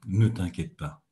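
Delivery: low, gentle and settled; calm, soothing "Ne t'inquiète pas". Shown as a demonstration. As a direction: say it softly to reassure. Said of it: "avec une voix calme, apaisante".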